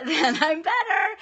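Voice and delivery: high, slow laughing repeat; a real laugh through tears. Real, not acted. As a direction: laugh it off while crying.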